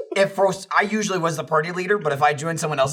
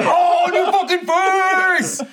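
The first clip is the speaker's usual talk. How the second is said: high, slow, louder laughing gloat; gloating taunt; 5 dB louder, much slower than usual. Retold or imitated. Gamer taunting the player he just killed, laughing gloat. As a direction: crow over the kill while laughing. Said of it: "and I'm like".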